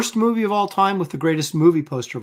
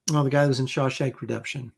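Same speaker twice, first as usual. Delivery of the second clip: usual speaking voice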